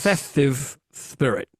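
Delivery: slowly, each sound of festive spirit clear; slow careful enunciation. Shown as a demonstration. To the host who misheard, slow and deliberate. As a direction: spell out festive spirit slowly, not spit. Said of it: "Uh, fest-, festive".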